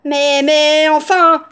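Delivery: put-on loud, stammering child; flabbergasted protest. A narrator's reading. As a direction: stammer out your disbelief loudly. Dumbfounded girl protests.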